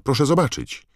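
high, loud insistence; urgent plea. A narrator's reading. Ron begs Snape to look at Hermione, urgent shout. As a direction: urge the teacher to look. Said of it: "zawołał Ron".

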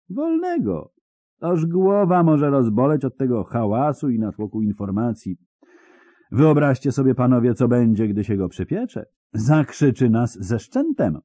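high, loud, jeering mockery; mocking amusement. Interrogator mocking the screaming spy to his men, jeering. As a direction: laugh at his panic while you speak.

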